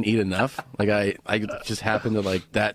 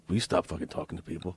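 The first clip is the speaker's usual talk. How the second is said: annoyed friend; 6 semitones lower, 8 dB quieter, faster than usual. Retold or imitated. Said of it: "he goes".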